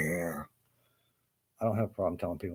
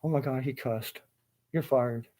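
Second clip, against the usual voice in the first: higher, louder imitation of an offended boss; hammed-up outrage; mock shocked boss; 6 semitones higher, 4 dB louder than usual. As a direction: act scandalized by the swearing. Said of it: "they're like".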